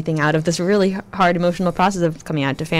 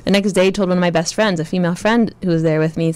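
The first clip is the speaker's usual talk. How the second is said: usual speaking voice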